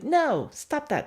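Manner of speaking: high, slow drawn-out refusal; the dog drawing a line; voicing the dog. Dog backing away from the petting hand, high slow refusal. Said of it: "He's like".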